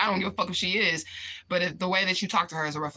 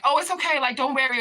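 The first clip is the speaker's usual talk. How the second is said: high, louder hurried comforting; pitched-up consoling quote; retold reassurance; 8 semitones higher, 4 dB louder than usual. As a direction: reassure over a row, voice lifted.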